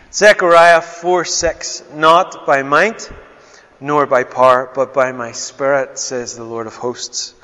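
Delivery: slow, emphatic reading of scripture. Acted. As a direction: read the verses with weight. Reads scripture aloud.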